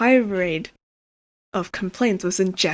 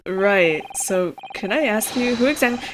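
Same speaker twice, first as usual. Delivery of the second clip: usual speaking voice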